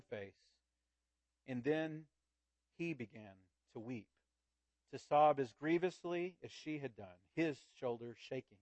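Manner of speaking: earnest storyteller reading aloud to the congregation; story narration. A narrator's reading. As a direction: read it like a tale told to a child. Preacher reads Wangerin story aloud.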